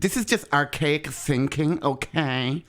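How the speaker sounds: put-on slow, lispy Valley Girl scolding. Host dismissing archaic thinking, drawn-out Valley Girl voice. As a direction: drawl it with a rising Valley Girl lilt. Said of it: "I'm just doing a Valley Girl voice".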